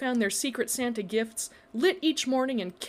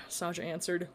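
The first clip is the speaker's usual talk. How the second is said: plain reading voice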